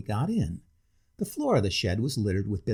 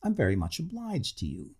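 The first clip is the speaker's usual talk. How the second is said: put-on overly polite, slightly drunk; a touch of tipsy grandeur; tipsy civility; 6 semitones higher than usual. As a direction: lay on the politeness a little tipsy. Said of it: "exaggerated civility of slight intoxication".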